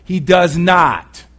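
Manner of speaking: slow, loud, every word hit; firm denial. Real, not acted. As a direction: plant each word firmly. Preacher insists firmly.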